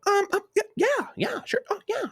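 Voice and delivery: very high mimicked girlfriend voice; hesitant agreement. Girlfriend accepting the to-go box, high hesitant mimicry. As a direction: squeak out an uncertain, over-agreeable yes.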